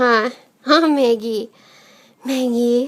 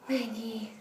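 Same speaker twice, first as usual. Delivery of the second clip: hushed whisper of a name; 5 semitones lower, 17 dB quieter than usual. Real, not acted. Calling softly for the dog.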